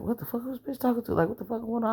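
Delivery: high, hushed disbelief; incredulous. Retold or imitated. Threatened by her friend, he reacts in hushed, high incredulity. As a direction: mutter it in stunned outrage. His outraged inner reaction.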